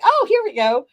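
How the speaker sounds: high, loud, sudden delight; surprised excitement. Retold or imitated. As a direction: yelp as the camera catches it. Surprised camera starts recording.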